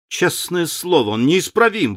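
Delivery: high, bright exclamation of mock outrage; exclaiming. A narrator's reading. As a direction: cry out in amused indignation. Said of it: "воскликнул Дориан". (с восклицанием, высоко)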